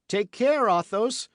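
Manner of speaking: high, loud urgent warning; sharp cry of alarm; cried out warning. A narrator's reading. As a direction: shout the warning to him. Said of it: "cried D'Artagnan".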